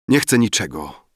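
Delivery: usual speaking voice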